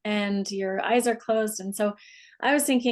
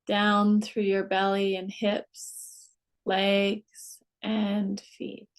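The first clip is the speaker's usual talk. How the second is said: guided meditation. Acted. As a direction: ease them down unhurriedly, phrase by phrase. Leads a guided relaxation.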